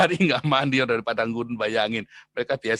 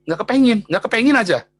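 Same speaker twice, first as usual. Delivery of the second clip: louder and higher, insisting firmly; emphatic insistence; 8 semitones higher, 8 dB louder than usual. Acted. Preacher insists to the congregation, loud and emphatic. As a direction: hammer home that you don't want it.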